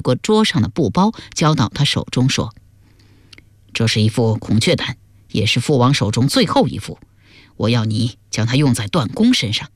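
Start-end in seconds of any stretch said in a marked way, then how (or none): 3.7-9.7 low-pitched royal father's voice; grave scheming father. Acted. Father handing his daughter poison, low grave instruction. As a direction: give the order low and deliberate.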